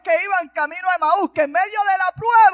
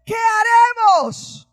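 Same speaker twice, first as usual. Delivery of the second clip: panicked shout; 7 semitones higher, much slower than usual. Acted. Servant shouting to Elisha about the siege, panicked and high. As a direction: yell it like the enemy is at the gate. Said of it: "Este hombre llegó gritando".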